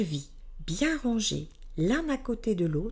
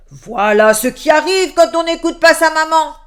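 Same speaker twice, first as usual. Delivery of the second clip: loud, higher booming shout; big bear shouting; 7 semitones higher, 14 dB louder than usual. A narrator's reading. Big bear shouting after the fleeing girl, loud call. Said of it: "lui cria de sa grande voix".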